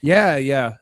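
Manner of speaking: usual speaking voice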